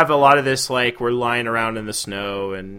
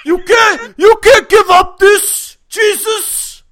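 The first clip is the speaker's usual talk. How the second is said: high, loud, slow yelling; Keitel shout; 11 dB louder, much slower than usual. Retold or imitated. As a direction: yell it like an outraged Keitel. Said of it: "Keitel was like".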